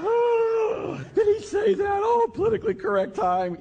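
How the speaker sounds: very high, loud scandalized squeal; mock outrage. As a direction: gasp and shriek as if horribly shocked. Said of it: "we go on and go".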